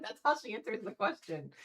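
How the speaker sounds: ordinary delivery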